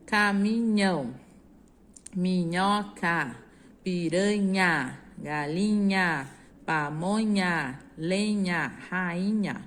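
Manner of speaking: words drawn out slowly for the class; slow reading aloud. Shown as a demonstration. Teacher reading the answer words slowly to pupils. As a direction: read each word out slowly and clearly. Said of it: "Qual dessas sílabas completa?".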